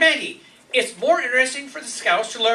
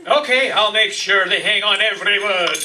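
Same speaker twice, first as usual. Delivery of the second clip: usual speaking voice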